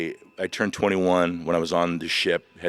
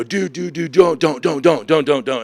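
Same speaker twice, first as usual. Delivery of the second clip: higher, louder repeated plea; pleading shout; 6 semitones higher, 6 dB louder than usual. Retold or imitated. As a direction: beg him not to hang up. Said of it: "I go".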